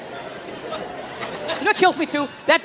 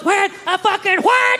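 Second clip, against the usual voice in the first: duck call; 7 semitones higher, slower than usual. Retold or imitated. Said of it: "Mine goes".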